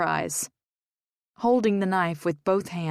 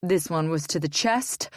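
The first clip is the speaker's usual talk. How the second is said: ordinary delivery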